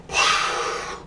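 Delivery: eerie breathy spirit sigh; unvoiced haunting exhale; ghostly sigh. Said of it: "some spirit was gonna come out of the wall like".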